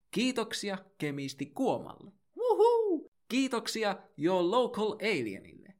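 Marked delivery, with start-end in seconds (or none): 2.4-3.1 very high, loud falling wuhuu cheer; whoop. Acted. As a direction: cheer like a fan at a concert. Cheer after each patron thanked.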